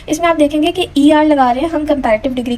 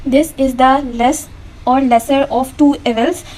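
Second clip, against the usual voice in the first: slow, deliberate example sentence; slow example read; much slower than usual. Shown as a demonstration. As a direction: read very slowly, word by word. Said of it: "खुद से choose करना है".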